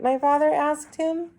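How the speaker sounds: ordinary delivery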